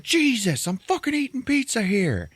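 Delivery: high-pitched exasperated outburst; imitated losing it. Son throws mom off over pizza, outraged. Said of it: "fucking loses it".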